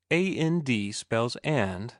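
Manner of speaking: "A N D" spelled letter by letter, slow; spelling out letters. Shown as a demonstration. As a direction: spell it out slowly like a teacher. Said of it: "You pronounce it an".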